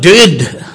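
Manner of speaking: "did" as one loud, stressed word; emphatic did. Shown as a demonstration. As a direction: hit "did" hard, alone. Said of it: "is so emphatic".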